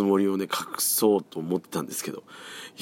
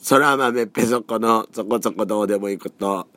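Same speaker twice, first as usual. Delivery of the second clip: show title call; 4 semitones higher, 6 dB louder than usual. Acted.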